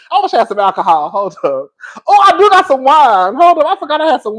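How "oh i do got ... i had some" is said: high, loud burst of delight; suddenly thrilled to remember the wine; excited. Real, not acted. Remembering the wine she forgot, high excited shout.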